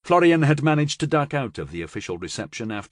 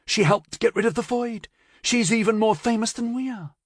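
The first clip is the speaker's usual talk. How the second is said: put-on excited young woman; 12 semitones higher than usual. A narrator's reading. Carilla gossiping excitedly.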